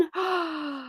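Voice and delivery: sudden sharp gasp of alarm; startled intake of breath. Real, not acted. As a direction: gasp at the spoiler threat. Reacting to near spoiler.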